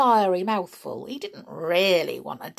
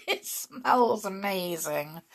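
laughing; 4 dB quieter than usual. Real, not acted. Laughs at gingerbread smell.

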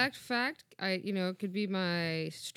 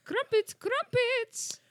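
much higher, louder mock British lady; British lady accent; 13 semitones higher, 6 dB louder, much slower than usual. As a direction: trill crumpets like a posh English lady. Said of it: "I can definitely do the British lady".

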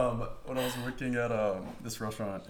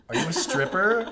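much higher and louder squeal; tipsy bachelorette moms; 11 semitones higher, 11 dB louder than usual. Retold or imitated. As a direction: squeal it like a tipsy party mom. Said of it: "they're like".

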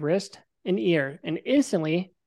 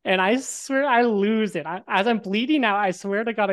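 ordinary delivery

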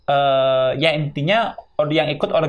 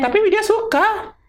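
teasing. Real, not acted. Teasing friend eating cake.

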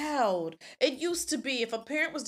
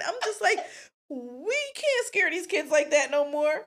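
ordinary talk, nothing marked

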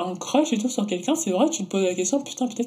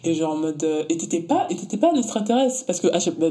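usual speaking voice

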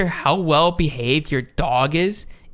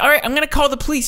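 fast, high, louder mock threat; freaking-out woman; 12 semitones higher, 5 dB louder, much faster than usual. Woman threatening the man filming her, mock freak-out. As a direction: imitate a woman losing it, rushed and high. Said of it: "losing her shit".

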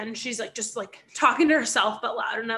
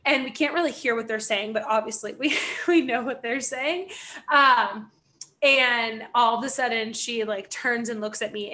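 plain reading voice

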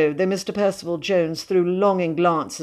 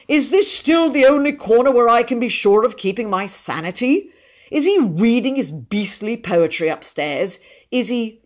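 higher, loud indignant questions; exasperated complaint; 6 semitones higher, 5 dB louder than usual. Acted. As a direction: demand relief from an unbearable guest. Miserable father complains of poetry reading.

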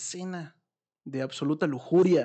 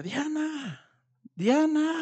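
higher call rising to a louder shout; 13 semitones higher, 3 dB louder, much slower than usual. Retold or imitated. Grandmother calling for her missing granddaughter, imitated shout. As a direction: call the name across the house. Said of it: "Y empieza a gritar".

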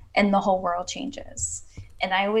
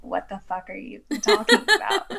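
a laughing take on a baffled skeptic; laughing mock disbelief. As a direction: toss out the skeptic's line through a laugh. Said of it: "they're like".

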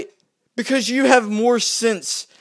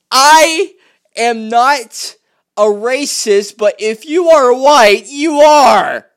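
higher, loud sneering parody of a liberal; mocking quote; 5 semitones higher, 9 dB louder, much slower than usual. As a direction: ham up their hypocrisy, loud and high. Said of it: "you are this dumb to go".

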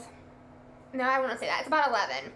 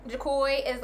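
ordinary talk

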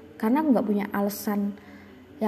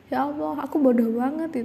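mild frustrated lament, pitched up; self-reproach; 5 semitones higher than usual. Retold or imitated. Student feeling stupid, crying out to God in mild despair. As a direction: scold yourself, half despairing.

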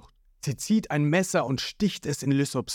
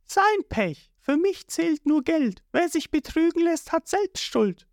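high, dismissive voice; cold Nami. A narrator's reading. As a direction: shrug it off, only money matters. Nami answers coldly.

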